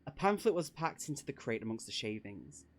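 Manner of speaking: plain reading voice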